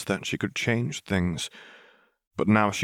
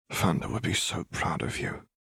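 softer, tender hushed line; whispered; 6 dB quieter than usual. A narrator's reading. Caitlin softly comforting Vi while they hug. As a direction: say it quietly into her wife's shoulder. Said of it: "she whispered".

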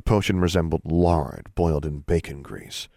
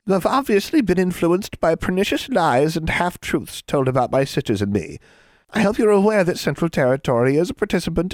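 high-pitched self-righteous lecture; indignant, officious hag; 9 semitones higher, 3 dB louder than usual. A narrator's reading. Hag lectures a boy about hurtful jokes, indignant. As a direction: lecture them like an offended official.

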